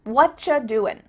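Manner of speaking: "What cha doin'" with ch and no final g; casual "wutchuh doin"; reduced whatcha. Shown as a demonstration. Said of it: "you just becomes cha".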